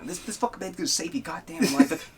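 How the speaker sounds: put-on quote voice. Quoting a video line.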